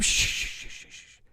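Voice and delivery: soft, repeated voiceless shushes; imitated shushing. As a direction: imitate a man hushing her, trailing away. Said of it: "the guy goes".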